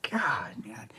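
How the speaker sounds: breathy unvoiced whisper, dropped right down; whispered aside. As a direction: let it slip out under your breath. Exasperated aside while recounting.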